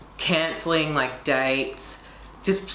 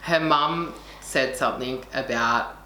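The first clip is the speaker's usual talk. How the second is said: ordinary talk, nothing marked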